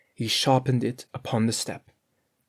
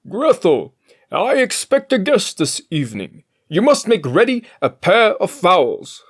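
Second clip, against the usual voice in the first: higher, louder, put-on master voice; master's voice; 8 semitones higher, 8 dB louder than usual. A narrator's reading. Master telling the cook to prepare fowls, higher and louder. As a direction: speak as the master giving orders.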